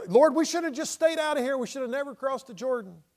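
high-pitched exaggerated lament; mock whining complaint. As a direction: whine it up high to the Lord. Mimics Joshua's whining complaint to God.

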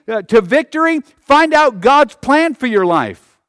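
loud, high pulpit cry; impassioned preacher, shouting the declarations; full-force preaching. Real, not acted. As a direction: drive it home to the whole congregation.

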